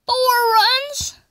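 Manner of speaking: high, outraged complaining; indignant disbelief. Real, not acted. Gamer fuming at his pitcher who blew the lead, high indignant rant. Pitcher gives up four runs.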